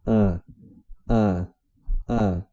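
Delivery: "A" as a separate, open "ah". Shown as a demonstration. Teacher drilling slowly for beginners, open isolated "A". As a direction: say each "A" wide open, pausing between. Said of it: "Mentaliza o A aberto com acento".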